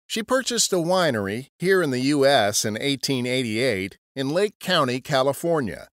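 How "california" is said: ordinary delivery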